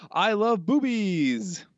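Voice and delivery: high, slow, hammed-up voice; silly high stretched-out declaration; goofy quote voice. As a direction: draw it out like a giddy kid.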